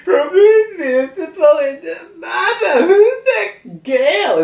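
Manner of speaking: high-pitched, drawn-out cartoon sobbing; hammed-up tearful wail; crying Zeus. Zeus sees Prometheus with a girl, mock crying. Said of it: "being sad and crying".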